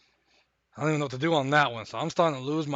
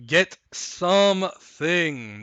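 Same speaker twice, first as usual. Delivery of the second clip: drawn-out plea; 4 dB louder, much slower than usual. Acted.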